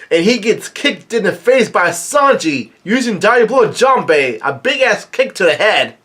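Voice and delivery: high, swooping play-by-play excitement; excited recap. Real, not acted. Fan retelling Sanji's kick to the face, excited. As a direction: hype up the big hit.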